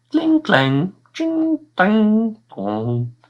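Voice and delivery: high sing-song clangs; clanging noises. Acted. Narrator mimics the knights' armour clanking. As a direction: ring them out playfully.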